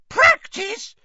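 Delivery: piercing, loud, very high outburst; furious squeal. A narrator's reading. Winky scorns Harry's remark, furious squeal. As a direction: squeal it in outraged disbelief. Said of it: "squealed Winky furiously".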